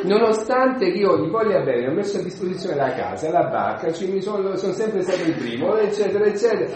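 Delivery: high, loud mock-indignant rant; aggrieved Peter. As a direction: complain indignantly, piling up the grievances. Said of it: "Pietro poi si incazza un po'". (sfogo risentito e teatrale)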